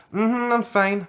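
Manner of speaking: high squeaky fake innocence; fake innocent. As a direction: chirp it guiltily. Caught about to pee.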